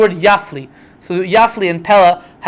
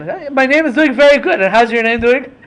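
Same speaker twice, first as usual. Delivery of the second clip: mock answer; 6 semitones higher than usual. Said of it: "mocking reply in argument".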